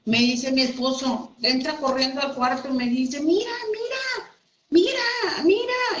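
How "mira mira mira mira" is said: much higher, urgent mock husband voice; excited husband. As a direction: imitate the husband rushing in, high and urgent. (esposo entrando corriendo, voz mucho más aguda y urgente)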